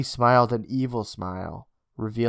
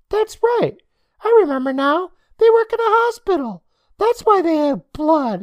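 put-on loud, squeaky kid with a grin; very high child voice; high-pitched boy; 6 dB louder than usual. A narrator's reading. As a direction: pipe it up like a gleeful little boy. Grinning Day Day.

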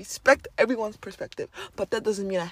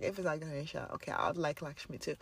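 much softer, inner doubt; quiet private doubt; 6 semitones lower, 14 dB quieter than usual. Retold or imitated. Admitting doubt to herself, much softer. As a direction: murmur a backup plan.

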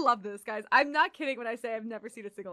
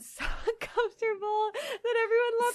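laughing; 6 dB louder, slower than usual. Real, not acted. Laughing through the story.

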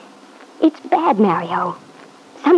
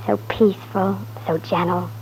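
softer, lower, fond and tender; tender hush; 4 semitones lower, 7 dB quieter than usual. Acted. Wife recalling her sleeping husband, tender hush. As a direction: murmur it like watching him sleep.

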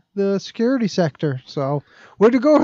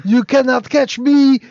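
higher, louder cheeky taunt; taunting outlaw; 6 semitones higher, 4 dB louder than usual. Retold or imitated. A fleeing gangster taunting the police, mock high jeer. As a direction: jeer at the cops from the rooftops.

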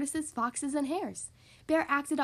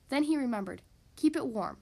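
usual speaking voice

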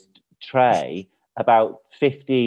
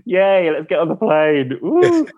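high, hammed-up cheer; sarcastic yay, leaping up in pitch; mock excitement; 9 semitones higher, 5 dB louder than usual. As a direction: whoop with fake glee about flying. Mock joy after hating planes.

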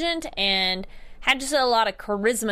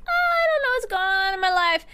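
a much higher, lost and fretful voice; lightly voiced unsure Mercy; 10 semitones higher than usual. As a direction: play Mercy, helpless and confused.

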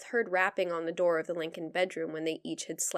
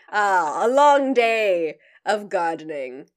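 imitating Churchill; 9 dB louder than usual.

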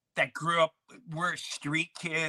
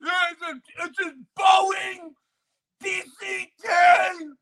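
put-on the kid insists about the Boeing; mock pleading shout; 8 dB louder than usual. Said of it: "It's like".

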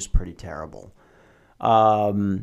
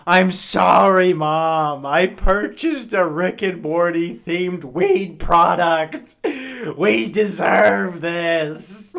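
higher, slow, hammed-up confession to mom breaking into a laugh; mock guilty apology; 5 semitones higher, 4 dB louder, slower than usual. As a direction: plead with mom, half laughing at yourself. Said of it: "I'm like".

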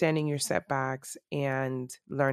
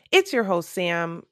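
loud, high burst of a host intro; too loud; 3 semitones higher, 6 dB louder than usual. Real, not acted. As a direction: introduce yourself a bit too loudly. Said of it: "Sorry for that being so loud".

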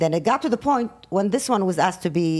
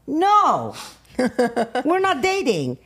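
protesting, laughing; 6 semitones higher, much slower than usual. Retold or imitated. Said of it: "And I'm like".

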